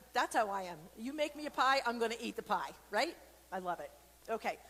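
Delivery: plain reading voice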